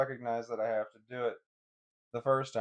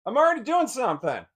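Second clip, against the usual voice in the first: frustrated yell; 11 semitones higher, 12 dB louder, faster than usual. Real, not acted. Streamer interrupted mid-stream, yells back exasperated.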